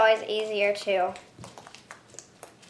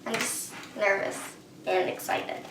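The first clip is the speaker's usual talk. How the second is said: softer, sheepish and hushed; quiet admission; 5 semitones lower, 12 dB quieter than usual. Real, not acted. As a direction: mumble it, embarrassed after screaming. Embarrassed after screaming.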